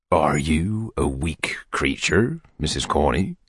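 low, slow, half-whispered question; intimate wooing. A narrator's reading. Beadle draws his chair closer, low intimate murmur.

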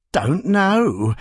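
usual speaking voice

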